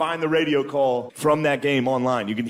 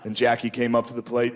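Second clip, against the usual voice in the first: usual speaking voice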